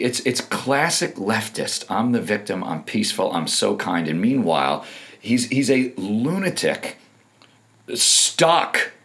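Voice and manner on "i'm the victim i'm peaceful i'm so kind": ordinary talk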